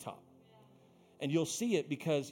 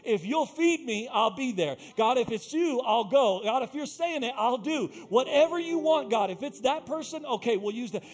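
preaching at full force; 7 semitones higher, 6 dB louder than usual. Acted. Pastor voicing a prayer of surrender to the church, preaching at full force.

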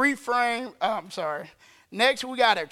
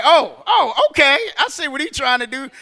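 emboldened realisation; 3 semitones higher, 8 dB louder than usual. Acted. Acting out standing up to enemy.

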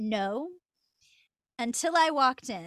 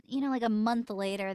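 usual speaking voice

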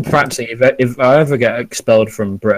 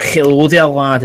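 mock Glaswegian accent; 3 semitones higher, slower than usual. Glasgow man greeting a fourteen-year-old, mock Scottish accent. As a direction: imitate a Scottish lad-hailing headbutter. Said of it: "Glasgow hello".

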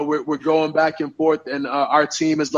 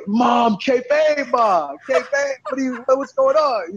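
high-pitched, mock-dismayed plea; exasperated pleading; 9 semitones higher, slower than usual. Wrestler pleading with mom who blew his cover, exasperated mock wail. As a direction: pitch it up, imitating an exasperated son.

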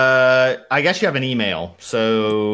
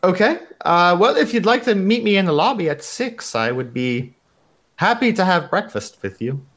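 put-on higher-pitched receptionist with a German accent; Ramona; 6 semitones higher than usual. Receptionist agrees to breakfast with a guest, German accent. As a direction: accept politely in a loose German accent. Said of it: "some variation of my shitty German accent".

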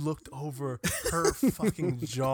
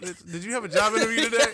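mock incredulous question; 9 semitones higher, 9 dB louder, faster than usual. Mocking teller's slip.

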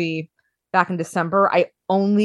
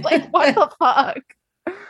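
laughing; 7 semitones higher, much slower than usual. Real, not acted. Laughing disbelief about Best Picture.

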